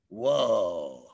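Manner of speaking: ordinary delivery, nothing marked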